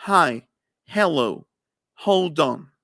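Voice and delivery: hi, hello and hold on with a breathy English h; English h demo. Shown as a demonstration. Teacher showing her class the English h. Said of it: "cómo se pronuncia el sonido de la H".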